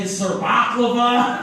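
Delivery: Serbaklava, slow, mangled like Sir Baklava; mock mispronunciation. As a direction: drag out Serbaklava as a clumsy mispronunciation.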